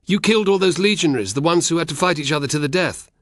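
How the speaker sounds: high and loud, bursting out; blurted outburst. Acted. Frightened boy accusing Spartacus, blurting it out loud. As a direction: let the accusation spill out all at once. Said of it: "Arnax blurted".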